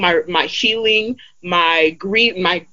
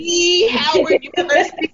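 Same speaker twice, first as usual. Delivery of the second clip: excited; 9 semitones higher than usual. Real, not acted. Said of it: "What? The?".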